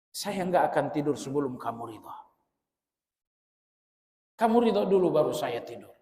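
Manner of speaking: preacher voicing a wife's tender apology, quiet and gentle; soft pleading wife. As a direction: coax him softly, voice dropped low. Wife pleading softly with angry husband.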